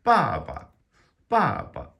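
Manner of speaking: BA loud and high, dropping sharply; mock-angry falling tone. Shown as a demonstration. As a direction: pretend anger, punch BA down from high. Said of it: "you just pretend like you're angry".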